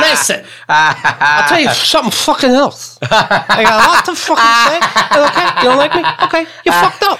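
put-on slurred, rising drunk rant. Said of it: "break out the drunk voice".

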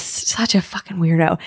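whispered aside; hushed, unvoiced insult. Real, not acted. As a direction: drop to a secret whisper. Aside insult about the guy.

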